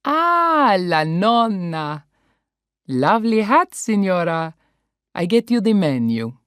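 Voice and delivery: put-on warm, loud Italian-accented welcome; a lightly voiced Italian waiter greeting. Italian waiter welcoming the family, cheerful accent.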